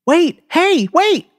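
high loud call to stop. A narrator's reading. Girl calling after the narrator to wait. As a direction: call after him across the lot.

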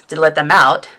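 usual speaking voice

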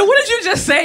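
laughing disbelief. Retold or imitated. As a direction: laugh through the question.

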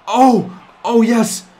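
loud, high joyful whoop; excited cheer. Real, not acted. Gamer cheering as his team ties the game, excited. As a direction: celebrate the big shot. Celebrating a tying basket.

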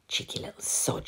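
whispered to herself. A narrator's reading. Mum whispering about her cheeky son, to herself. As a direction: breathe it under your breath, no voice. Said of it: "she said quietly to herself".

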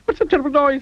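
high, squeaky cry of alarm; panicked cry. A narrator's reading. Mister Jelly wakes with a start, panicked cry. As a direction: cry out in fright at a noise. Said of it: "he cried".

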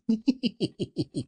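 high, long tittering laugh; gleeful giggle. Acted. Scrooge delights in his secret gift, high giggling. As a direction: giggle helplessly until it runs out.

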